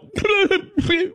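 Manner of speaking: very high honking sob-laugh demo; crying noise demo. Shown as a demonstration. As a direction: show the pathetic crying noise. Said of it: "It's the noise I make when I cry".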